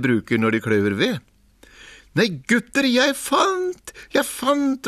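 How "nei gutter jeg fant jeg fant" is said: very high, gleeful shout; excited call. A narrator's reading. Askeladden shouting his find to the brothers, very high. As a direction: squeal out the find. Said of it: "ropte han".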